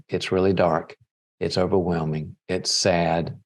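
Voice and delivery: low, unhurried comforting father; steady and grounded, holding someone in distress; gently voiced empathy; tender reassurance. Father comforting daughter in the well.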